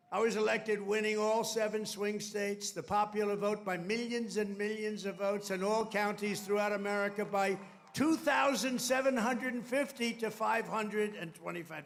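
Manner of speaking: loud, declamatory podium delivery; formal speech. Real, not acted. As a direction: proclaim it firmly to the crowd. Declaims election results.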